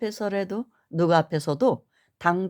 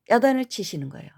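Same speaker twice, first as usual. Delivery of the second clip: usual speaking voice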